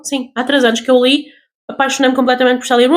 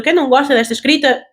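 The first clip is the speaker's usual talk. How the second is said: normal talking voice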